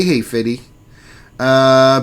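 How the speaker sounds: ordinary delivery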